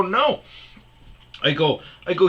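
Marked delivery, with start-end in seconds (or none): 0.0-0.4 high, emphatic refusal; retelling an exasperated objection; indignant retold refusal. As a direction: shoot the tech down, pitch jumping up. Retold flat refusal to tech.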